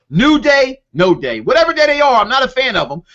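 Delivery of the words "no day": lower, dismissive mock name; dismissive jab. Real, not acted. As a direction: toss the nickname off with scorn. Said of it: "I'm sorry".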